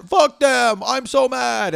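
mock angry tweet. Mocking fans' angry tweets.

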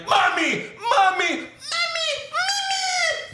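very high mock squeal, climbing. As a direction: imitate a whiny mama's boy. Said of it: "every time his voice gets higher".

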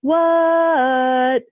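high, long-held sing-song reply; sing-songy eerie answer. Retold or imitated. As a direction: sing it back high and drawn out, stepping down. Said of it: "answered in the same voice".

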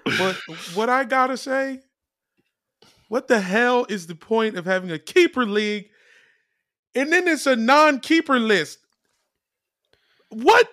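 high, loud disbelief; exasperated. Real, not acted. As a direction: voice climbs in outrage. Exasperated rant about keeper rules.

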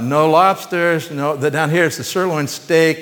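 ordinary talk

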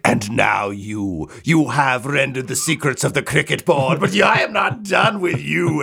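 rising into a shrieking hag threat; vicious hag voice. Acted. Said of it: "the sort of, like, vicious hag voice from which he speaks".

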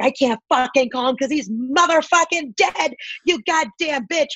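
half-real furious rant; retold rant. Retold outburst at Costco clerk.

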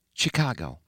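the President quietly naming the city to Murray. A narrator's reading. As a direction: say it quietly, almost to herself. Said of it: "she said quietly".

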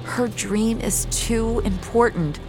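high, slow and regretful; anguished justification. Acted. Rescuer leaving man for the girl's dream, anguished. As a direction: explain slowly, pained by the choice.

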